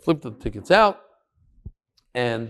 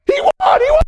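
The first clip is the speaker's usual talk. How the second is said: much higher, loud cheering yell; yelled in wild joy, pitched way up; ecstatic shout; 8 dB louder than usual. Retold or imitated. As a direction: cheer the win at the top of your voice. The room erupts over the winner.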